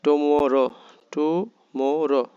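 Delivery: Tomorrow in slow, stretched syllables; pronunciation model. Shown as a demonstration. Teacher has learners notice the pronunciation of Tomorrow, syllable by syllable. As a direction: draw out each part of Tomorrow firmly. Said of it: "উচ্চারণটা একটু খেয়াল করতে হবে".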